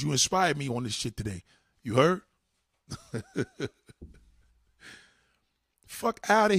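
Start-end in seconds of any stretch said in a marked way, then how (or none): 2.9-3.7 short high-pitched laugh; brief amused cackle. Real, not acted. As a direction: let out a quick squeaky laugh. Laughs at own rant.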